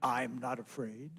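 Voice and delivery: low, slow, soft and calm; a big old frog, unbothered; deep frog voice. Acted. Brother Frog answers the scared mouse, low and slow.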